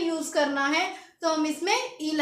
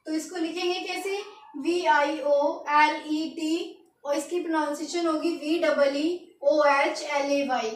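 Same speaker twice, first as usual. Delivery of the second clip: usual speaking voice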